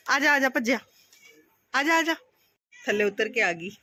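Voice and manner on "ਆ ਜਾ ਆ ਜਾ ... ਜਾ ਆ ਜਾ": higher, coaxing call to a cat up high. Real, not acted. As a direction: call her down sweetly. Calling the cat down.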